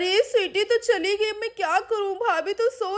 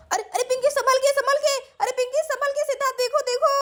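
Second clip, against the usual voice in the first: high, panicky calls; alarmed warning; 8 semitones higher, faster than usual. Acted. Onlooker warning Pinky as she slips, alarmed. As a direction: warn frantically in a raised pitch.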